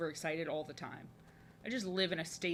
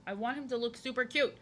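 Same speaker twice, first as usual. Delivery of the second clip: ordinary delivery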